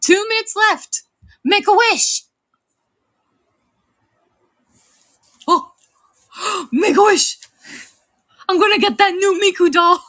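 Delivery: high-pitched, excited countdown. Real, not acted. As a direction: squeal it out loud, racing to make a wish. Countdown to make a wish.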